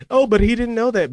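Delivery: high, whiny mock of his excuse. Mocking the stranger's excuse.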